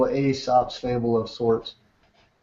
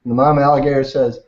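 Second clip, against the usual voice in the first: usual speaking voice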